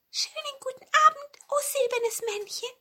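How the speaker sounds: very high, thin squeaky greeting; tiny mouse voice. A narrator's reading. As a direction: squeak politely like a little mouse. Two mice greet the silver cone. (piepsige, hohe Mäusestimme)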